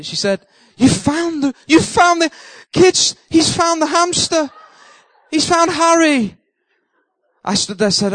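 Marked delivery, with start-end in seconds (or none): 0.8-6.4 high, slow, thrilled cry; delighted disbelief; overjoyed neighbour. Retold or imitated. Neighbour telling her kids the hamster's found, high and elated.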